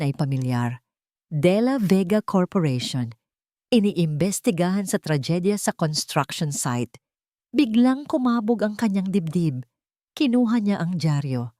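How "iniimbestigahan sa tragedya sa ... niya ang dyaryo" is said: ordinary delivery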